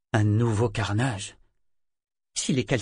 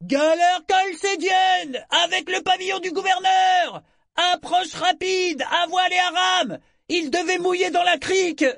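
lookout's shouted report; 4 dB louder than usual. A narrator's reading. Lookout reports the approaching galley, shouting. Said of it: "qui ajouta un instant plus tard".

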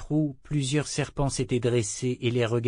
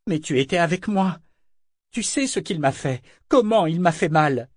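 higher, building to a loud accusation; hurt protest; 5 semitones higher, 5 dB louder than usual. A narrator's reading. Althea appeals to the ship as witness, hurt protest. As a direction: plead to be believed, louder on the hurt.

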